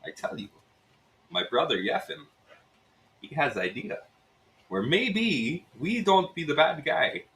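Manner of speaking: Russian innkeeper coaxing them into a scheme; Russian accent. Acted. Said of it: "it's Russian".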